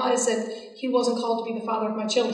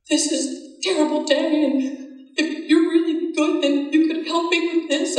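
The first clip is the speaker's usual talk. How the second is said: higher, slightly pleading mock prayer; imitating her own prayer; 6 semitones higher than usual. Retelling her one-sided plea to God, a higher, mildly pleading mock voice. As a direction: replay your old whiny plea to God, lightly.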